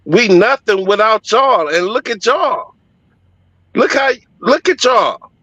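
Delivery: high, urgent echo of the women's plea; pleading church woman. Retold or imitated. Retelling the women on stage, high urgent pleading. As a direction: plead with them to look at themselves.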